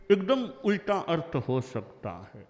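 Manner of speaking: ordinary delivery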